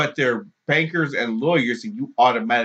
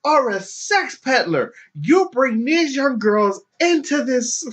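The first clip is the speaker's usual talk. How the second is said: shrieking accuser; 12 semitones higher than usual. Retold or imitated. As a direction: preach the charge at him. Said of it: "the protester accuses again".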